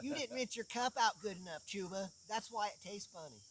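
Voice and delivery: high, nagging mother imitation; naggy mom voice. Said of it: "That was naggy".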